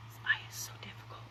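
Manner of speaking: whispered and quick; whispered aside. Real, not acted. Applying mascara to a tricky eye, whispered complaint. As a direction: mutter it to yourself under your breath.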